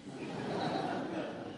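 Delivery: soft unvoiced chuckle; quiet rueful laugh. Real, not acted. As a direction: laugh under your breath. Laughs at being set up.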